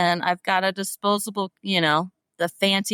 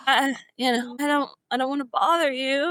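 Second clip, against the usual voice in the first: high, fast, nervous starstruck fan; flustered fan; 8 semitones higher, faster than usual. Retold or imitated. Retold flustered fan reply.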